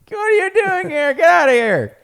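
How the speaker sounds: loud, high crotchety mock shout; old person yelling. Dog as an old person to visitors, cranky yell. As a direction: chase them off like a cranky elder. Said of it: "You're like an old person".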